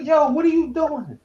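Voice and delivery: normal talking voice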